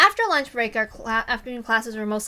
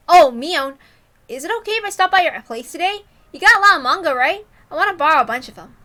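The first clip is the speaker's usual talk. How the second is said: louder, higher, breezy pretext; loud casual cover; 6 semitones higher, 10 dB louder than usual. Acted. Keiichi asking Mion loudly, a pretext for talking alone. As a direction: sound casual while hinting.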